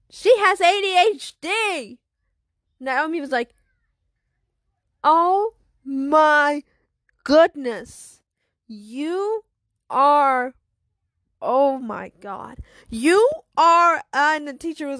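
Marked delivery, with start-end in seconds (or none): 0.1-2.0 slow, high, loud announcement; classmate calling out. Acted. Classmate reacting to the rambling girl, loud call. Classmate calls out after the rant. 5.0-14.0 loud, drawn-out disbelief; acted loud astonishment. Girl stunned by friend's confession, loud disbelief.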